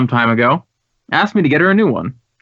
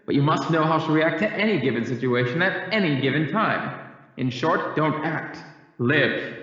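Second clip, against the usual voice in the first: heard from the back of an auditorium, softer; a drama coach preaching to his class from afar; distant lecturing teacher; 9 dB quieter than usual. Acted. Drama teacher addressing class from stage.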